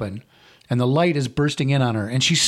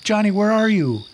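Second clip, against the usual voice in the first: high, raised calling into the dark; 9 semitones higher than usual. Acted. Girl alone in the car calling for Johnny, high and raised. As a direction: call out for him, afraid. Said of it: "So she calls out to him".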